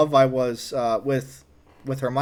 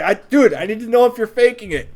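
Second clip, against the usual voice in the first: higher, louder, fast pleading demand; urgent demand; 7 semitones higher, 5 dB louder than usual. Retold or imitated. Young [name] demanding the truth from teen, urgent and fast. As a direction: press him hard for the truth.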